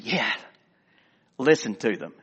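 low, unhurried, savoring each word; quietly appreciative. Acted. Jesus answering indignant Pharisees about the children, calmly appreciative. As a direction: answer the outrage with calm delight. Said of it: "Jesus is on a totally different page".

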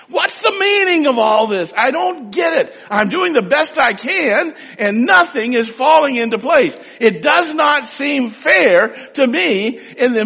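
high, loud, drawn-out shout; shouting to the Lord. Shown as a demonstration. Preacher showing the congregation how to shout to God, loud and high. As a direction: cry out your frustration to God full voice. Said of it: "it's okay as well to shout to the Lord".

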